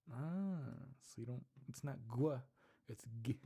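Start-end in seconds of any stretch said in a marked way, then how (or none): none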